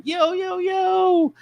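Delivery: very high, sing-out "yo"; hammed-up party-entrance call; gregarious greeting. Walking into a shop full of strangers, mock gregarious. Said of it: "that gregarious".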